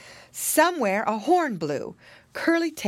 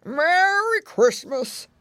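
put-on slow, slurry old pig character; high, drawn-out grandpa greeting; another voice crying out; 10 semitones higher, 4 dB louder, slower than usual. A narrator's reading. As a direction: roll it out slowly as an old pig. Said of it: "cried another voice".

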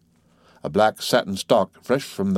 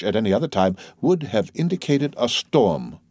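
ordinary delivery, nothing marked

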